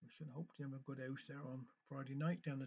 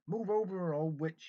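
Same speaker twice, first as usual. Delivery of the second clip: impatient call; 2 semitones higher, 7 dB louder, slower than usual. Acted. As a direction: shout her out of the way, pitch up and loud. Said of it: "he impatiently called".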